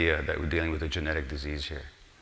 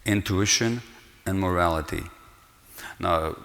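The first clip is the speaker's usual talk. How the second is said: ordinary talk, nothing marked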